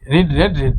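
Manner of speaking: রিড said as reed, রেড as red, then রিড as reed again; three forms of read. Shown as a demonstration. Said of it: "এটার উচ্চারণ হবে রেড".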